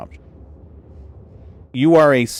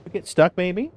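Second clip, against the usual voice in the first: high pet voice; 6 semitones higher, 12 dB quieter, faster than usual. Real, not acted. Streamer cooing at her tangled cat, high baby talk. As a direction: coo at the stuck kitty.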